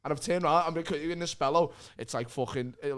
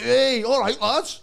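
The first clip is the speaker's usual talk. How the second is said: loud hearty greeting; 8 semitones higher, 8 dB louder, slower than usual. Retold or imitated. Imitating overbearing Lee greeting.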